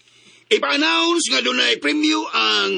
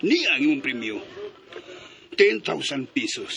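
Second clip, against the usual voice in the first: low gentle voice; 10 semitones lower, 4 dB quieter than usual. Acted. Father hands over prize gently.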